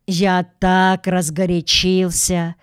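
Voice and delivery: ordinary delivery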